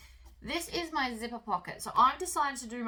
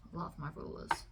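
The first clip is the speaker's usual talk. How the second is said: whispering; 6 semitones lower, 7 dB quieter than usual. Real, not acted. Affectionate aside about her rulers.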